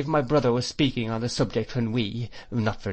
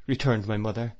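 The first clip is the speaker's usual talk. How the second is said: ordinary delivery, nothing marked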